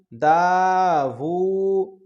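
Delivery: دا وو with both vowels held long; dictation-slow دا وو, vowels pulled long; drawn-out vowels. Shown as a demonstration. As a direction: stretch دا and وو slowly. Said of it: "کھینچ کے پڑھ رہے ہیں".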